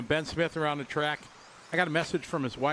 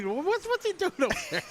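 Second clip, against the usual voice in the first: much higher mock voice of a puzzled bystander; puzzled onlooker; 13 semitones higher than usual. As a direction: pitch it way up as the confused guy watching. Voicing onlooker's puzzled thought.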